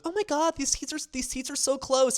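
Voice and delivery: high mock-gushing voice; impressed date. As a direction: gush like a dazzled date. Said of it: "she was like".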